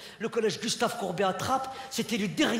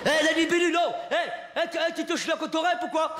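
mocking taunt; 8 semitones higher than usual. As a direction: heckle them from the top of the stairs. Kids insulting from up high.

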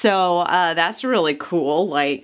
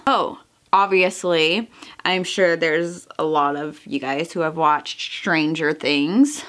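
ordinary talk

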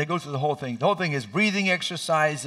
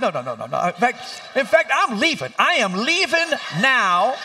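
rising higher and louder, slowed to the end; squeamish refusal; 8 semitones higher, 6 dB louder than usual. Retold or imitated. Father refusing to look at surgery, panicking higher. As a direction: back out in alarm. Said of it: "I said".

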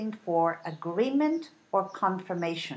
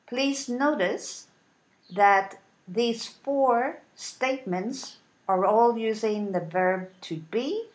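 normal talking voice

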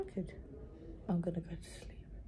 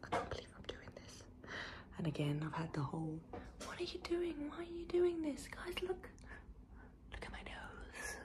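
soft, hushed nervous whisper; 8 semitones higher, 10 dB quieter than usual. Real, not acted. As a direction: whisper so the ward won't hear. Whispering in hospital before surgery.

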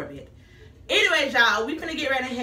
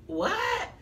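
shocked what; 9 semitones higher than usual. Real, not acted. Shocked by cancelled order email.

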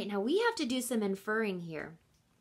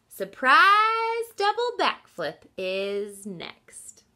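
slow, louder proud announcement; bold boast; 4 semitones higher, 8 dB louder, much slower than usual. Acted. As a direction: announce it grandly, each word deliberate.

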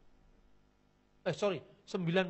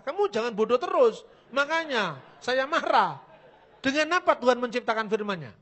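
loud, high scolding, slowing at the end; angry rebuke; 5 semitones higher, 9 dB louder than usual. Retold or imitated. Preacher scolding the Witness who asked him not to be angry, loud rebuke. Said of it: "Loh Bapak jangan marah".

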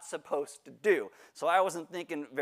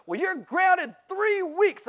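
stern mom; 13 semitones higher, 5 dB louder, slower than usual. Retold or imitated. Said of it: "And she goes".

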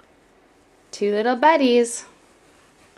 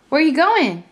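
playful high call; 8 semitones higher, 4 dB louder than usual. Real, not acted. Calling to toddler on stairs.

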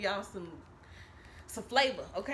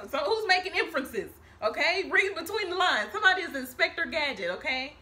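emphatic; 5 semitones higher, 6 dB louder than usual. Real, not acted. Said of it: "I'm just giving y'all emphasis".

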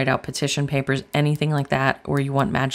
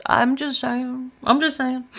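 cheeky sing-song; 9 semitones higher, slower than usual. Real, not acted. Cheeky confession about cookies.

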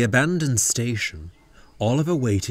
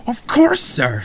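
high, loud, cheerful agreement; delighted yes to the boss; happy; 14 semitones higher, 7 dB louder than usual. A narrator's reading. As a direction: answer eagerly, pitched way up. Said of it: "said Oliver happily".

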